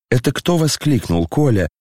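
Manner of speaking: plain reading voice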